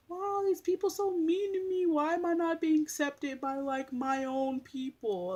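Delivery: high whiny imitation of her old sad self; mock whiny crying. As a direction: whimper it, mocking the old her. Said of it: "I used to cry, like".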